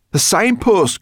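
high, loud shout on spotting something; excited cry. A narrator's reading. Sorrel spots the signpost from the dragon, excited cry. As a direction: call out the discovery, high and loud. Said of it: "cried Sorrel".

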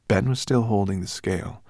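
ordinary delivery

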